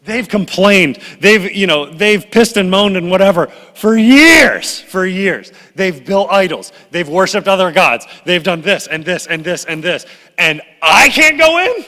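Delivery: high and loud, building to a cry at the end; indignant rant. Retold or imitated. Moses protesting to God after years of complaints, indignant. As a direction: pour out years of grievance, rising to outrage.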